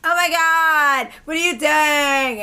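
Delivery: slow, stretched campy exclamation; drawn-out mock gushing; flamboyant gushing man. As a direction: imitate an over-delighted party guy. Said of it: "he's like".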